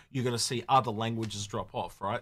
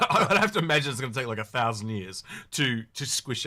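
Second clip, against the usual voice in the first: starts with a laugh, louder and quick; 5 dB louder than usual. Real, not acted. Podcaster guessing how long languages take to merge, laughing. Amused at his own prediction.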